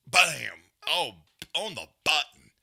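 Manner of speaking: sudden loud bark of Bam; loud punch call. Real, not acted. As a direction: call the hit out hard. Calling the knockout punch.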